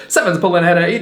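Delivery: a touch of excited race call; fun announcer voice. Shown as a demonstration. House calling the race for players, light announcer voice. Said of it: "the fun announcer voice".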